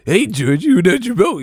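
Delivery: high, loud creepy clown voice. Retold or imitated. Pennywise clown voice mocking guys.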